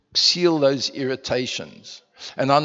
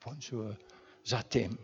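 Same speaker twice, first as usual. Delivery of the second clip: whispered-soft French, much softer; soft French endearment to a wife; 3 semitones lower, 14 dB quieter than usual. Shown as a demonstration. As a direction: murmur it tenderly to your wife. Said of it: "speak more French to her".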